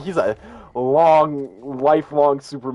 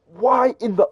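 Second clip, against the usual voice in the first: higher, louder imitated yell; mock scream; 10 semitones higher, 5 dB louder, slower than usual. Superfan watching the trailer, mock screaming. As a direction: yell it like a fan seeing a bad trailer. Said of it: "he was screaming".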